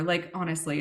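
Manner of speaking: normal talking voice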